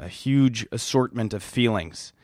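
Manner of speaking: ordinary talk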